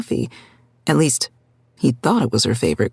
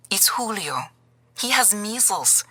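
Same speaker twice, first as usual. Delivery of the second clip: put-on higher, softer old grandmother voice; a frightened abuela breaking the news; worried grandmother; 7 semitones higher, 7 dB quieter than usual. A narrator's reading. Grandmother calling Gabriel about Julio, higher worried voice.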